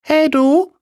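very high, loud character voice; another, unknown voice. A narrator's reading. Unknown voice hails the tailor, very high and loud. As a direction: pipe up loudly in a squeaky-high voice. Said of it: "sagte da eine andere Stimme".

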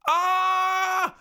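high, loud enraged scream; monstrous howl of rage; giant's scream. Acted. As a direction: let out a giant's furious scream. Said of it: "den Riesen schreien".

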